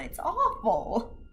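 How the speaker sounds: slow whimpering "awful"; half-cry it out slowly; mock wail over how cruel it is; whiny cry. Real, not acted. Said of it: "crying out".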